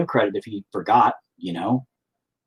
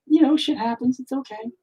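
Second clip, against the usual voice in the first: high, breezy brush-off; mock breezy; 13 semitones higher than usual. Brushing off his angry wife, a high breezy reply. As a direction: float it up lightly. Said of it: "And I'm like".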